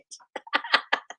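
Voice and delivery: airy squeal of a giggle; a high wheezing giggle; high breathy giggle. Real, not acted. Laughing at her own joke.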